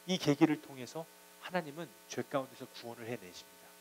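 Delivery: ordinary talk, nothing marked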